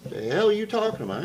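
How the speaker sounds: high-pitched incredulous retort; mock indignation. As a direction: act stunned and offended, pitch way up. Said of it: "And I'm like".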